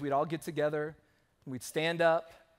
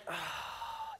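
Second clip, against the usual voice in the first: much higher, soft startled cry of dismay; shocked gasp; 11 dB quieter than usual. Retold or imitated. Pastor reacting to his mom's wrong emoji with a high startled gasp. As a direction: gasp in horror at what she sent. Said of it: "And I was like".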